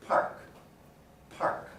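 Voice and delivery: PARK, its P released with a burst of air; aspirated P. Shown as a demonstration. Teacher demonstrating the release, aspirated park. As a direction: puff the P of park. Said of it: "there's this burst of air".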